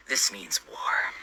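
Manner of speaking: slow, hushed whisper of vengeance; grim whisper. Acted. Sitting over a ketchupless hot dog, vowing revenge in a slow grim whisper. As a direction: breathe it slowly like a vow of war.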